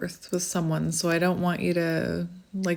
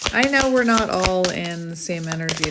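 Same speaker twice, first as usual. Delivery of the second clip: usual speaking voice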